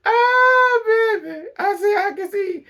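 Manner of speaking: high, loud wail through laughter; gasping out words while crying with laughter; crying laughing. Real, not acted. Crying with laughter over the friends.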